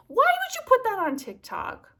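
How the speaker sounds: very high, loud protest; exasperated outburst. Acted. Scolding people who post sad pet videos, exasperated. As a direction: shriek it at whoever posted the video.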